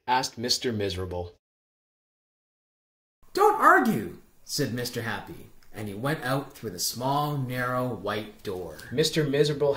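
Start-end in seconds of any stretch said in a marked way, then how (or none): 3.3-4.2 much higher, firm bright voice; cheery insistence. Acted. Mister Happy insists, high and cheerful. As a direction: brush off objections brightly.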